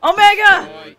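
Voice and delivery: loud, high cheer; excited shout. Real, not acted. Player greeting a favorite character, excited high shout. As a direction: shout the name high, at full volume.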